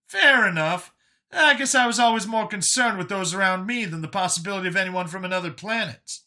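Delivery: high-pitched character voice, starting loud; Brian's conceding voice. Acted.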